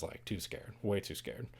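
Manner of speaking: breathy hush of shame; whispered admission. Real, not acted. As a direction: drop to a near-whisper. Admitting fear, voice drops.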